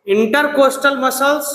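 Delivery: drawn-out slow dictation. Shown as a demonstration. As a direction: spell it out slowly for the class to repeat. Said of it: "बोलो". (धीरे-धीरे बोलकर लिखवाना)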